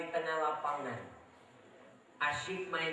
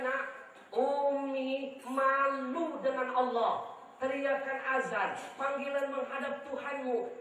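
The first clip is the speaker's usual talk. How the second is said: mother's voice; 9 semitones higher than usual. Retold or imitated. Mother telling her son to come home, higher gentle firmness. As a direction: sound like a mother gently shaming her boy. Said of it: "ibu saya langsung berkata".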